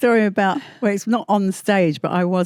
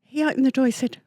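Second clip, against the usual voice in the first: normal talking voice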